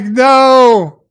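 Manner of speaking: a long, high, loud cry of no; retold shout of no. Dahl shouting as the fourth ship decloaks, in a long, loud cry. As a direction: wail the word out in despair. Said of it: "He's like".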